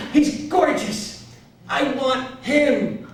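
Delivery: very high, slow, smitten character voice; Marla. Retold or imitated. Woman admiring the piano player, high slow smitten imitation. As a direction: imitate her drawing out each word. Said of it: "and she's like".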